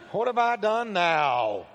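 slow, drawn-out put-upon protest; exaggerated little brother. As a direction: stretch out the kid brother's complaint. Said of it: "You can hear a little brother in there".

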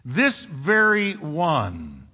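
"this very one" drawn out very slowly; emphatic. Shown as a demonstration. Preacher repeating the emphatic rendering, "this very one" slow and weighty. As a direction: stretch and weight each word of "this very one". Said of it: "is emphatic".

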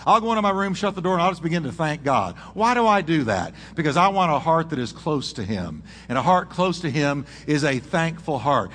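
ordinary delivery

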